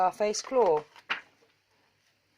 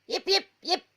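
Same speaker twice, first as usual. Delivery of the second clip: high little yips; small dog yip; 11 semitones higher than usual. A narrator's reading. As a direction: yip like a tiny dachshund. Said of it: "said Schnitzel von Krumm".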